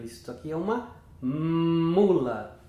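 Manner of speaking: "mula" slowed, the "m" stretched; exaggerated first sound. Shown as a demonstration. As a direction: slowly stretch the start of "mula". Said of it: "vai exagerar no som". ("mula" devagar, alongando o "m" inicial)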